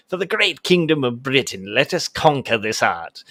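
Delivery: lightly voiced pompous British explorer; a hint of a grand colonial gentleman. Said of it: "sounding like a pith helmet wearing colonial British gentleman".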